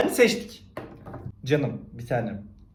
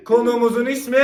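loud; 8 semitones higher, 7 dB louder than usual. Real, not acted. Louder retake of intro.

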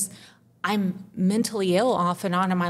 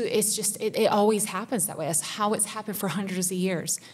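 ordinary delivery

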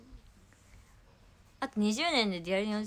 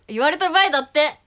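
louder, mocking Kumamoto dialect repeat; 5 semitones higher, 13 dB louder than usual. Shown as a demonstration. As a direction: repeat the Kumamoto phrase loud and teasing. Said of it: "熊本弁出た。". (熊本弁をおどけて繰り返す)

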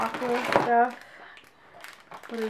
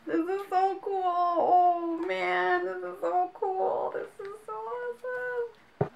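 teary, much higher voice breaking with happiness; overcome, crying as you gush; crying with joy; 8 semitones higher than usual. Real, not acted. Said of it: "I wanna cry right now".

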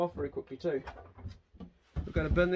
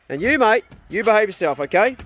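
raised, stern warning to a dog; 6 semitones higher, 9 dB louder than usual. Real, not acted. Owner warning his dog to behave, loud and stern. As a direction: lay down the law loudly.

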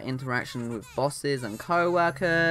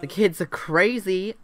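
put-on louder, higher, fed up with the kids; exasperated outburst; 6 semitones higher, 4 dB louder than usual. Babysitter fed up with the Sims kids, exasperated outburst. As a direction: throw it out in mock despair.

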